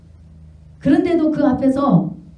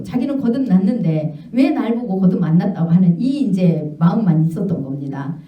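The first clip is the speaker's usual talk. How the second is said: usual speaking voice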